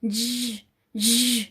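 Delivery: ज as a soft buzzing zzz, no vowel; ज as a voiced hum, no vowel; buzzing z sound. Shown as a demonstration. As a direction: buzz the z like a bee. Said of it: "ज होता है".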